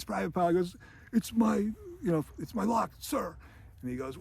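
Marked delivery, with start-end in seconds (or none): 1.1-3.4 high put-on answer to a superior; lightly acted cowed recruit voice; imitating nervous recruit. Recruit answers the sergeant about his lock, mock nervous reply.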